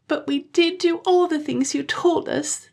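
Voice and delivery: put-on high child voice crying despairingly; despairing children. A narrator's reading. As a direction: protest like desperate little kids. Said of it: "the children cried despairingly".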